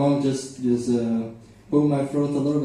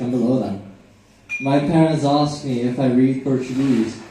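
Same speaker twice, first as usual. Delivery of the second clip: ordinary delivery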